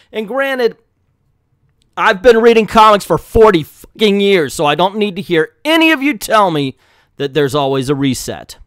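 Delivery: loud, exasperated rant with hard stresses; angry rant. Real, not acted. Reviewer heading off commenters, angry rant. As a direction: go off on them, loud and forceful. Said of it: "to go off like that".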